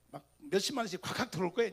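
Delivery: ordinary talk, nothing marked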